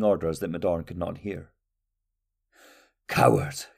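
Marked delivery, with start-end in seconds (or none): 3.1-3.7 low, scornful soldier voice; a soldier sneering at a distant king; spat insult. A narrator's reading. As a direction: drop low and spit it out with contempt. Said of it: "Card said".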